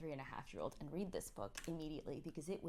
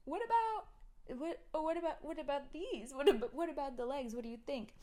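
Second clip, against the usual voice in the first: mock sing-song parent; 8 semitones higher, 6 dB louder than usual. Mother imitating herself asking kids, sing-song. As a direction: coax the kids.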